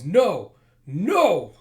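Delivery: loud, high defiant cry; anguished scream. Acted. Mila glowing with power over fallen friend, screamed refusal. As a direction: scream it out in two bursts.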